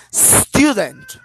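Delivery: "Student" with STU punched loud and high; stress on the first syllable. Shown as a demonstration. Teacher showing the class correct word stress, first syllable punched. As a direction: stretch and hit the start of "Student", let the end drop. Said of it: "At the beginning, we need to have the stress".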